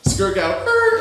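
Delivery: imitated squealing tires, very high and loud; mock skid noise; tire screech.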